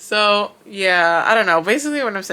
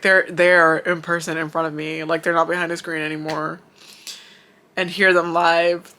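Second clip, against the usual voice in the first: ordinary delivery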